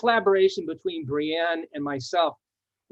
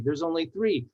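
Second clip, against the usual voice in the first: ordinary talk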